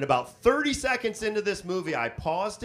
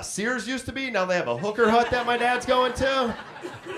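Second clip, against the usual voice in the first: ordinary talk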